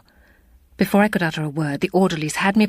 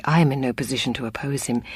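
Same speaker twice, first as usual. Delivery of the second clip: ordinary delivery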